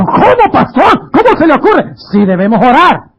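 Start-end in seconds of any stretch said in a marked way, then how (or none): 0.0-1.9 high, shocked disbelief; incredulous objection. Retold or imitated. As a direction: play the scandalized listener. Congregant objection voiced by preacher. (objeción incrédula y aguda)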